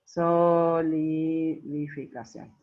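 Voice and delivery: «Solidificación» muy lento, con las primeras sílabas estiradas; dictado silabeado. Real, not acted. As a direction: alarga «so» y «li» de «Solidificación» como quien escribe. Dicta mientras lo escribe. (dictando lentamente, sílaba por sílaba)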